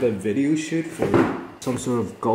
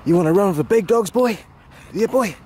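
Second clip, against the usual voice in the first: teasing dog talk; 6 semitones higher than usual. Real, not acted.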